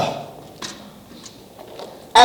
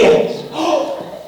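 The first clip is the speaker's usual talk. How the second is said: startled gasp; 9 semitones higher, 4 dB quieter than usual. Acted.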